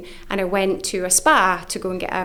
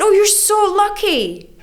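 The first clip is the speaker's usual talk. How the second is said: much higher, slow and sing-song; gushing phone lady; 12 semitones higher, 6 dB louder than usual. Retold or imitated. Charity woman telling her she's lucky, slow high gush. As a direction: stretch it out, gushing.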